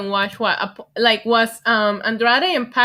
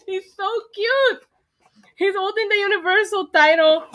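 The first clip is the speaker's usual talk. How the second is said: gushing cute voice; 9 semitones higher than usual. Real, not acted. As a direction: coo over the little figure. Gushing over figure.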